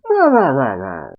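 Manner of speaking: imitating a roaring cat laugh; hearty laugh. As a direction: belly laugh like a big cat. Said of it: "Nekomamushi's just laughing".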